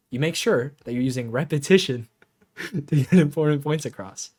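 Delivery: laughing repetition for emphasis; repeated with a laugh. Shown as a demonstration.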